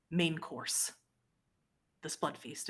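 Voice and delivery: normal talking voice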